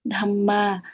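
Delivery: "tham ma" with each syllable lengthened; two long syllables. Shown as a demonstration. Teacher repeating two long sounds, long syllables. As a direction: hold both "tham" and "ma" long. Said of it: "cả hai từ này đều là âm dài".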